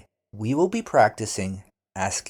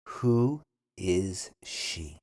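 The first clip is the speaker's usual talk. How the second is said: Who is she? even slower, lower; slow repeat; 3 semitones lower, slower than usual. Shown as a demonstration. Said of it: "then repeat slowly".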